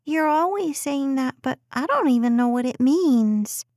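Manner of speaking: put-on high child's voice begging to stay; a preschooler pleading to stay upstairs; pleading little girl. A narrator's reading. Daughter teasing mom.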